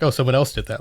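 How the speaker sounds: normal talking voice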